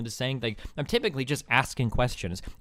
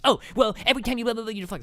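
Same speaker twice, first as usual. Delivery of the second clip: higher and louder mock whine; mocking the opponent; 8 semitones higher, 4 dB louder, faster than usual. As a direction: exaggerate his dismissive blah blah. Mocking the Naked Ape guy.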